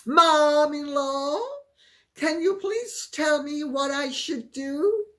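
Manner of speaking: mock helpless daughter-in-law asking for advice; pleading Meghan. Meghan begging Diana for advice, pleading.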